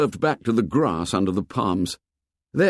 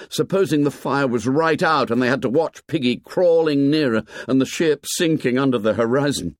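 usual speaking voice

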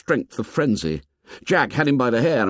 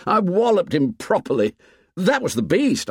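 excited boast; 8 semitones higher than usual. Acted. Ralph boasting about his hit.

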